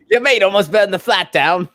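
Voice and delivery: put-on Australian accent; awful Australian accent. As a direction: imitate an alarmed Australian flatmate. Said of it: "My awful Australian accent".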